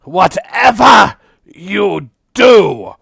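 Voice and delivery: high, booming proud goblin shout; a proud goblin bellows a warning; goblin's loud warning. Acted. Goblin shouts warning to the party, high and booming. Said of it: "he repeats".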